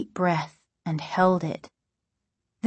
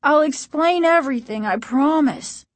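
higher, earnest plea; pleading; 6 semitones higher than usual. A narrator's reading. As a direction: beg to be believed. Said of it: "he pleaded".

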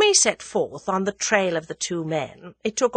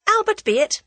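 cheerful; 5 dB louder than usual. A narrator's reading. Tuppence cheerfully answers boy.

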